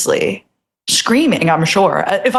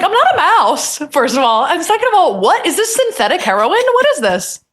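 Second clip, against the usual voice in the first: incredulous; 7 semitones higher than usual. Retold or imitated. Retold indignant reply to mom.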